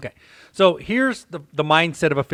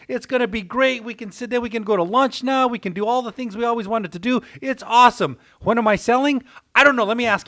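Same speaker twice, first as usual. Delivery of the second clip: higher, excited wife on the phone to her sister; ecstatic wife; 6 semitones higher than usual. Retold or imitated. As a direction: gush the good news like a thrilled wife. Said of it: "the wife immediately gets ecstatic".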